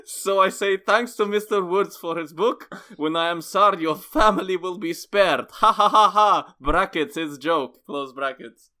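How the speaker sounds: high, loud Russian boasting; Russian accent. Acted. Host finishing Nikolai's review, boastful Russian accent. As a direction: crow triumphantly in a Russian accent, then settle. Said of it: "a bit of Russian in it".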